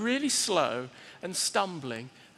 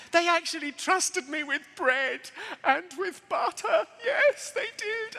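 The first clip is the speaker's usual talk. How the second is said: high, slow, hammed-up wonder; gushing, high-pitched astonishment; mock amazement; 9 semitones higher, slower than usual. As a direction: draw it out in giddy disbelief. Mock amazement at being trusted.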